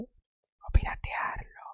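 slow, hushed whisper, drawn out; conspiratorial whisper. Pretend. Book host slyly suggesting piracy to listeners, conspiratorial whisper. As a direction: whisper it like a secret. Mischievous aside, hushed as if secret.

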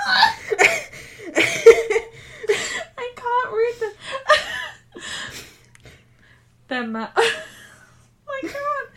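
high, loud tearful laughing; words break into laughter; laughing to tears. Real, not acted. Laughing to tears while reading.

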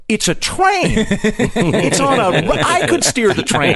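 high-pitched incredulous outburst; exasperated disbelief. Retold or imitated. Passenger retelling his reply to a train conductor, exasperated disbelief.

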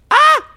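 high excited squeal. Real, not acted. Overexcited about the drag show.

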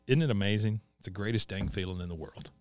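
low, hushed wonder; tender awe. Retold or imitated. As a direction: breathe it out, moved. Peggy rocking baby in tears.

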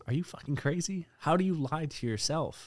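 high, disbelieving burst of outrage; can't believe anyone would do this; incredulous outburst. Real, not acted. Podcast host ranting to his co-host, high and incredulous. Ranting about lying to yourself.

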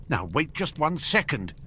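high, indignant interruption; understated thundering outrage. A narrator's reading. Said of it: "Hagrid thundered".

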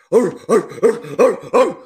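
loud mock dog barks; barking. Rival fan barking in the narrator's ear, loud mock barks. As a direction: bark it out like a rowdy fan. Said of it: "starts barking in my ear".

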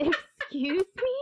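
high, loud, slow indignant excuse me; outraged disbelief. Retold or imitated. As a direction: stretch it out, shocked and offended. Mother says kid will be eaten.

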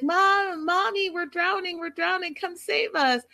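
high singsong calls for Mom; joking drowning plea. Retold or imitated. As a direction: daughters playfully crying for rescue, high-pitched. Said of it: "start joking that they're drowning".

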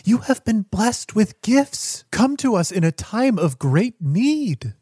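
rising, impassioned proclamation; fervent believer proclaiming a prophet. Acted. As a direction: preach it with mounting awe.

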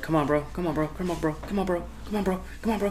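soft, rapid-fire murmured nagging; quiet, repetitive pleading as a dog; pestering dog voice. Retold or imitated. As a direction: keep nagging under your breath. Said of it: "She's over here like".

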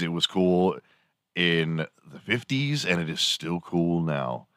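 ordinary delivery

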